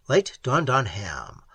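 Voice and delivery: ordinary delivery, nothing marked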